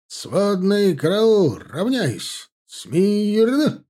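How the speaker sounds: slow, high parade-ground shout; shouting drill sergeant. A narrator's reading. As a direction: bellow the commands across the field. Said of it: "разнёсся крик высокого мушкетёрского майора".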